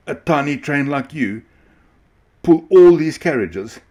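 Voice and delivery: high, loud scoffing disbelief; mocking. A narrator's reading. Ringmaster mocking little Huffy Puffy. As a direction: scoff at the tiny train. Ringmaster mocks small train.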